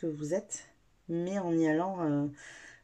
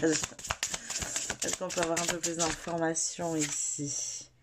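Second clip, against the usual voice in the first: slow incantation; much slower than usual. Acted. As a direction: ask it like a ritual chant. Slow incantatory question over cards. (incantation lente et douce)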